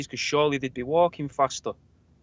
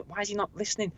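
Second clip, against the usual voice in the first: softer, higher private wondering; inner thought; 6 semitones higher, 8 dB quieter, faster than usual. Retold or imitated. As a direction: think it to yourself under your breath.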